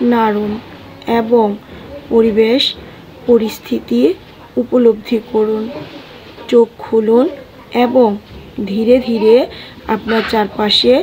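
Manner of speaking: slow meditation guide, short phrases with long pauses; guided meditation. Acted. Guide easing listeners into breath and calm, meditative delivery. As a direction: lead listeners gently through breathing, phrase by phrase.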